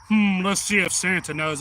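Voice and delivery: playful put-on guessing voice; Santa Claus. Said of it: "went into the character of Santa".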